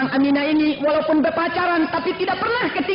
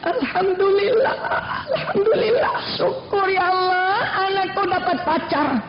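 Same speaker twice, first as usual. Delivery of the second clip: hammed-up overjoyed relief; mock thankfulness; 4 semitones higher than usual. Mother hearing of daughter's boyfriend, mock overjoyed. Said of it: "ibu bilang".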